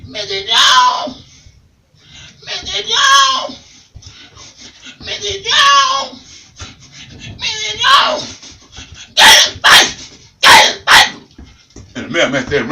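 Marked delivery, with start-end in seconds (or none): 0.1-8.6 very high, slow shrieking parrot; high crying parrot calls, drawn out; parrot squawk. Acted. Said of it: "The bird sounded like that". 9.2-11.8 loud slow hissed bird rasp; loud unvoiced parrot rasp. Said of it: "The bird sounded like that".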